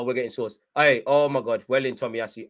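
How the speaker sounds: ordinary talk, nothing marked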